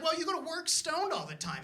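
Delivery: a hint of the scolding girlfriend. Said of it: "she was like".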